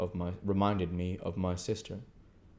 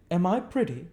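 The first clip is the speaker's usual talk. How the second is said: put-on young lady; 11 semitones higher, 6 dB louder than usual. Estella voiced.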